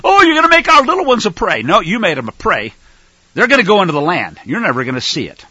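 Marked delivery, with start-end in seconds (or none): 0.0-1.6 high, loud, fast whining mimicry; mock whine. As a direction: parody the complaint. Mocking whining Israelites.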